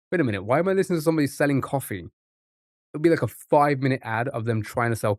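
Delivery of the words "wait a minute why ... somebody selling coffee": fast inner thought. Retold or imitated. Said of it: "and I'll be like".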